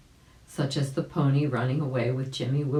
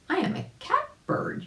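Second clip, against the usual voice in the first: much higher chirpy bird voice; catbird voice. Acted. Catbird to the rabbit, a high chirpy boast. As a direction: squeak it like a little bird.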